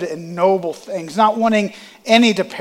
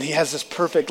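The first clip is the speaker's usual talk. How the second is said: ordinary delivery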